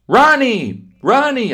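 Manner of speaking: high, loud call across the yard; hollering. Acted. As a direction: shout to a friend far off. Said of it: "I started hollering".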